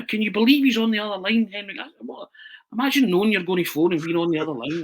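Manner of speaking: high, urgent and fast; panicked hurry to get him; flustered high-pitched pleading; frantic. Retold or imitated. Retelling frantic call to Keevins.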